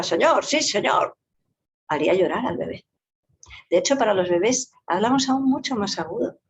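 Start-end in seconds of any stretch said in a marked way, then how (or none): 0.0-1.2 high, clipped soldier's answer; strong military voice. Retold or imitated. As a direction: snap it out like a soldier. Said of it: "Una voz fuerte, como la que dijera". (voz fuerte de soldado)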